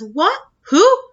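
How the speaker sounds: sudden high, loud startled squeak; voice gone funny. Retold or imitated. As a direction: caught off guard, pitch jumps up. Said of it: "his voice goes all funny".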